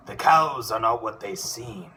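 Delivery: bold cartoon duck hero declaiming his entrance; Darkwing Duck voice. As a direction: grandly announce each line like a caped duck hero. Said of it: "this is Darkwing Duck, reading his famous entrance lines".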